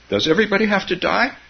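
put-on high, quick little-child voice; child's question. A narrator's reading. Grandchild asking Grandma about dying, high child's question.

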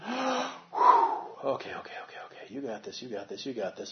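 hushed, whispered "okay"s, steadying yourself; mildly shaky pep talk to oneself; nervous self-talk. Pretend. Self-talk after pulling away.

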